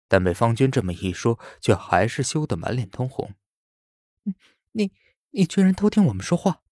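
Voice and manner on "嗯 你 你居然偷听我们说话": high, quick flustered accusation; flustered girl. A narrator's reading. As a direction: stumble then rush the reproach. Lin Wei accuses, embarrassed.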